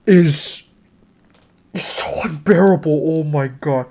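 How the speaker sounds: hushed, whispery exasperation; weary despair. Real, not acted. Reviewer appalled by the track's mixing, quietly despairing. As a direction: sink to a drained, low whisper of dismay.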